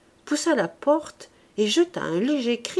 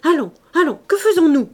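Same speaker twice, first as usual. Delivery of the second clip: louder, higher rousing call; brisk clapping call; 7 semitones higher, 9 dB louder, faster than usual. A narrator's reading. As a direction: hurry everyone along with a clap. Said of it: "il tapa dans ses mains en disant".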